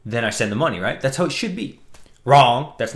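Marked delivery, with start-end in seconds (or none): 2.2-2.7 loud, punchy single word; emphatic wrong. Real, not acted. Vlogger correcting his own assumption, loud and punchy. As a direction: slam the word down to correct yourself.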